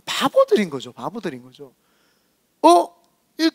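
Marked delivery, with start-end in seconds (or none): 0.9-1.7 much softer, lower repeat; hushed aside repeating the verdict; quiet echo. Real, not acted. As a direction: let it fall away to almost nothing.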